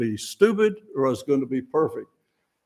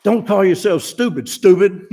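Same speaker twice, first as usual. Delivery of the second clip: louder, higher scolding of self; mock self-scolding; 6 semitones higher, 7 dB louder than usual. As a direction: scold yourself in a jokey bark. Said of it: "I didn't say".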